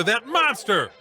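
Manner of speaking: normal talking voice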